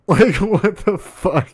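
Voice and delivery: high, slow, squeezed out through laughter; helpless laughing incredulity; laughing disbelief. Real, not acted. Podcaster stunned by friend who killed cat, laughing disbelief.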